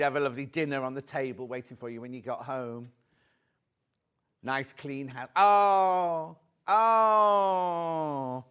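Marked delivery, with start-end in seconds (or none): 5.3-8.4 two long, loud, falling awws; drawn-out adoring coo; touched aww. Real, not acted. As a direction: gush "aww" at something sweet. Touched by boyfriend's sweet gesture.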